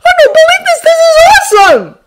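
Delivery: very high, loud squeal of excitement; thrilled boy, voice sky-high; disbelieving delight. A narrator's reading. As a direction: gush in a squeaky kid voice. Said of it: "disbelieving grin".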